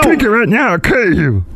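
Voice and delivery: high cartoon voice turned threatening; menacing brewmaster. Brewmaster threatens the host in a high cartoon voice. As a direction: push the root beer with a cartoon threat.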